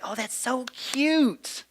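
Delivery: high, slow, drawn-out mock coo; mock gushing parent. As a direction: coo like a doting parent behind the camera. Mocking parent filming their kid.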